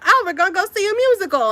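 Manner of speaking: put-on bubbly, fast, high kid excitement; excited. Twelve-year-old self heading to Broadway, fast excited chirp.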